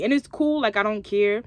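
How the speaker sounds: ordinary talk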